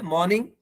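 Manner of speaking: "मॉर्निंग" said "maw-ning"; silent R. Shown as a demonstration. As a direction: drop the R in "मॉर्निंग". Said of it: "ये आर silent है". ("मॉर्निंग" में आर silent)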